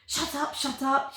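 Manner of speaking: hammed-up but understated fury; mild mock rage. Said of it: "shrieks the Yark in a fit of rage".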